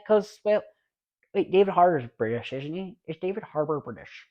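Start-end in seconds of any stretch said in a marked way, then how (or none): none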